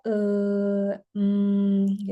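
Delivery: long drawn-out "eh... hmm"; hesitation fillers. Shown as a demonstration. Teacher showing students what not to do, hesitant filler sounds. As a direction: stall with droning, level filler sounds. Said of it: "Lebih baik diam daripada".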